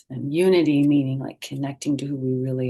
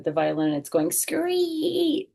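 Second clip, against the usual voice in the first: squeaky violin; 12 semitones higher, slower than usual. Retold or imitated.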